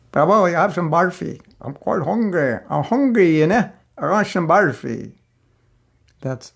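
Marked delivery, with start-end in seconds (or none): 0.1-5.1 Scottish brogue. Retold or imitated. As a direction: put on a hammed-up Scottish accent to ask for sweets. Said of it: "the Scottish brogue".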